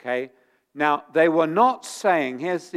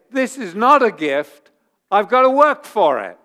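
indignant; 4 semitones higher, 7 dB louder than usual. Retold or imitated. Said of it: "Steve is not gonna be so happy".

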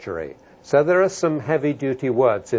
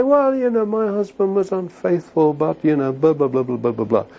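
mimicked excuse-maker. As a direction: imitate someone explaining it away, trailing into blahs. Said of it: "You see, some people say".

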